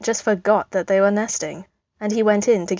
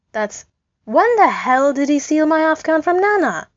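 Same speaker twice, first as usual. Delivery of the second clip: higher, louder outraged cry of disbelief; 9 semitones higher, 6 dB louder than usual. Acted. Sam spotting his Afghan in the nest, outraged higher louder disbelief. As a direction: shout it as if you just spotted your stolen blanket.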